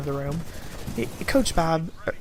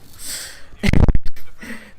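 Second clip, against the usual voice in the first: loud breathy laugh at own joke; burst of laughter; 8 dB louder than usual. Real, not acted.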